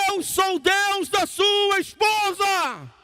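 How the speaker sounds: booming-slow, very high-pitched thunderous shout; shouted divine voice. Retold or imitated. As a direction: roar it out, stretching every word. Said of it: "aquela voz veio de novo". (voz divina gritando, lenta e aguda)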